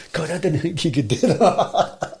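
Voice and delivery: imitated amazement dissolving into laughter; laughing quote. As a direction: quote his disbelief through a laugh.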